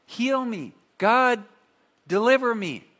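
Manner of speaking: loud pleading prayer. Retold or imitated. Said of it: "Paul's like".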